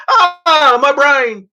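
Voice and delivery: very high, loud startled cry; mock fright; startled yelp. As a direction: jump out of your skin at a honk. Imitating startled driver.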